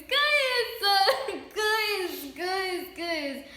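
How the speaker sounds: high, loud sing-song calling out to viewers, fading down; squealing excitement. Real, not acted. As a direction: squeal guys at them, can't contain it. Overjoyed at shoes, calls viewers.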